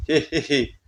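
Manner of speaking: quiet taunting snicker; soft evil giggle. Acted. Possessed bride sniggers at the call for the healer. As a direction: giggle with contempt.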